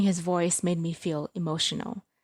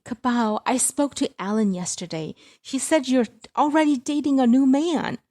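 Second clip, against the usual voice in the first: higher, louder scolding mother; mother scolding her daughter, voice raised high; reproachful mother; 7 semitones higher, 7 dB louder than usual. Acted. Mother accusing on phone.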